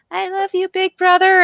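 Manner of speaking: put-on high, sweet little-girl voice; little sister voice. Little sister leans on her brother, cooing sweetly. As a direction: coo it like a doting little sister. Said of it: "she's leaning against him going".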